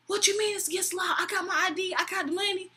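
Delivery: high, fast imitation of an angry drunk; mock indignant protest. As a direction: argue back as the indignant customer. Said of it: "so freaking mad".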